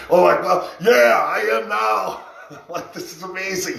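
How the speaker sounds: loud, laughing excitement; excited. Retold or imitated. Told about the weekend plans, laughing excited shout. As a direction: burst out thrilled and laughing.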